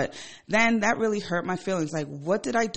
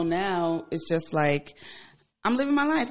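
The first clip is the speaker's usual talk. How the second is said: ordinary delivery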